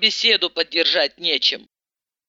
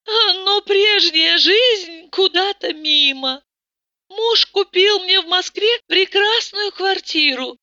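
higher, lighter voice of a sad society lady; Tata, wistful; 11 semitones higher than usual. A narrator's reading. Tata's voice in story.

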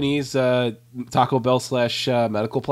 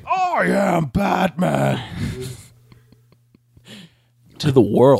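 slow dramatic Batman voice; 4 semitones higher, much slower than usual. Retold or imitated. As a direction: drag out I am Batman, slow and heavy. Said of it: "It's like saying".

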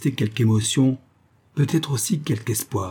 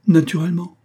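high, louder smug sneer; 8 semitones higher, 5 dB louder than usual. A narrator's reading. The madman mocking James, higher and louder with scorn. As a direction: toss it off with a cackling scorn. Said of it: "ricana le fou".

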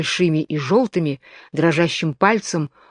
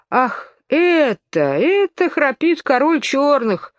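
twin's voice; 8 semitones higher, slower than usual. A narrator's reading. Twin explains the snoring.

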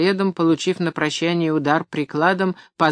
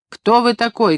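high, raised demanding question; shouted; 6 semitones higher, 4 dB louder than usual. A narrator's reading. Maxim challenging Funk's identity, shouting. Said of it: "крикнул Максим".

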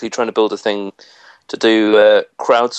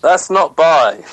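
teasing; 3 semitones higher, 4 dB louder, slower than usual. Real, not acted. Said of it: "Oh, bye".

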